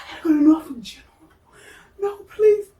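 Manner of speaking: high, loud mock-tearful plea; panicked begging. Begging doctor not to send her, panicked wail. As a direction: beg in an exaggerated high wail.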